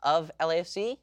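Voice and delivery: normal talking voice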